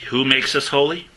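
preacher prompting an answer; call to congregation. Acted. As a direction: throw the question out to the room. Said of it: "asks congregation".

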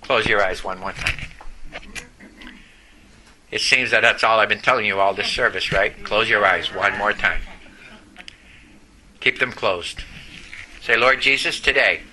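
low-pitched, solemn altar-call voice; leading a prayer. Real, not acted. Preacher to congregation with eyes closed, leading a prayer. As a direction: guide them into prayer in a low register.

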